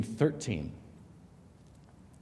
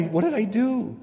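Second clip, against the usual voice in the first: higher and louder, bewildered; stunned disbelief, pitched up; replaying his shock at the slap, higher and louder; 11 semitones higher, 5 dB louder than usual. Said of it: "I was stunned".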